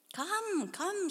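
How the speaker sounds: high, pleading call of come; imploring. A narrator's reading. Girl imploring the lonely man to join her, high and pleading. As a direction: beg him to come along, voice lifted high. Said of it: "she implored him".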